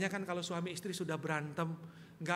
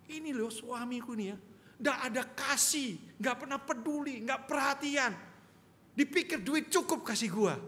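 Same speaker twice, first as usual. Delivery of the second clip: mock wife's complaint, a bit higher and slow; complaining wife; 6 semitones higher, 3 dB louder than usual. Wife complains to pastor about husband, mild mock grievance. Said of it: "Terus si istrinya ngomong".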